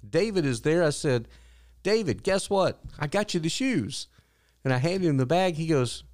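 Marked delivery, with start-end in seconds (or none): 1.8-4.1 high-pitched, eager announcement; excited. Retold or imitated. Customer surprising the barista with shoes, excited. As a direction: break good news with a lifted voice. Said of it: "I'm so excited now".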